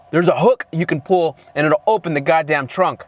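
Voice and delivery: normal talking voice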